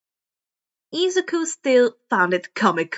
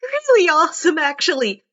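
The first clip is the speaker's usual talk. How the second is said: put-on higher, louder, bubbly girl inventor; Hatsume voice; 5 semitones higher, 5 dB louder than usual. Inventor answering her friend, high and bubbly. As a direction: gush about how great things are.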